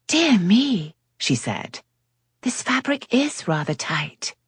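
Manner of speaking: a hint of a coy lady; lightly voiced lady. Cleo voiced, high female voice.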